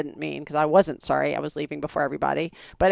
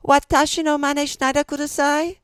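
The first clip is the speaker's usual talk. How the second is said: much higher-pitched Japanese; rehearsed polite request; 10 semitones higher than usual. Retold or imitated. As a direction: recite the learned phrase in a high voice. Retold rehearsed Japanese request to consul.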